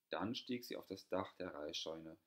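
plain reading voice

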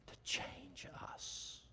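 whispered, slow and hushed; a hushed secret for the congregation. Real, not acted. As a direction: lean in and whisper it slowly.

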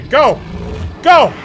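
loud, high yelling go; shouted command. Real, not acted. Player ordering the monster to go, shouting. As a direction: bark the order at the creature.

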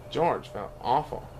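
ordinary delivery

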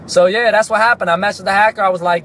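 ordinary delivery

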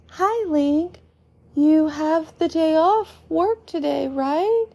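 put-on high-pitched young boy's voice; child checking hopefully, pitched high; Colin, greeting. A narrator's reading. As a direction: greet an older friend in a high kid voice. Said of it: "It is Colin from the night before".

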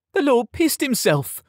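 high, gleeful crowing; jeering announcement. A narrator's reading. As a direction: call everyone to witness his shame. Tom announces it to everyone.

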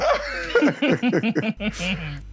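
a high teasing laugh at a friend's loss; mocking laugh. Friend laughing at his buddy's trading loss, mocking high laugh. Friend mocks Adé's losses.

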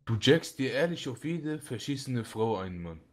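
normal talking voice

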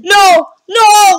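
high, loud game panic; panicked shout. Real, not acted. Gamer fleeing the shark player, panicked shout. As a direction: cry out as the shark closes in.

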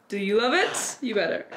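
ordinary talk

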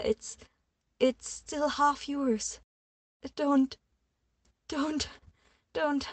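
high, loud pleading near tears; begging him not to hurt her baby, near tears; tearful begging. A narrator's reading. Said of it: "struggled to breathe and not start crying".

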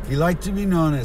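ordinary talk

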